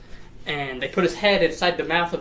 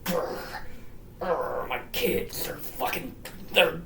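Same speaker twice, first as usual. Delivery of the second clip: hushed, growled put-on anger; mad father; 4 dB quieter than usual. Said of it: "he's very mad".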